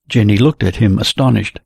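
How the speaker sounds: usual speaking voice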